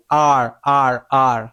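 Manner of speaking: আর repeated with a wide-open mouth. Shown as a demonstration. Teacher drilling A plus R for learners, wide-open আর. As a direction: open wide for each separate আর. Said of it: "হা করতে হবে".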